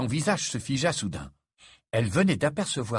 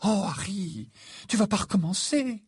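exasperated higher-pitched plea; 11 semitones higher than usual. Acted. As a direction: groan at a friend who starts up. Exasperated friend cuts Harry off.

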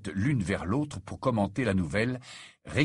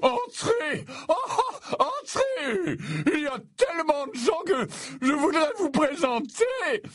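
put-on booming old professor. A narrator's reading. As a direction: boom out a hearty welcome. Slughorn voiced, far high.

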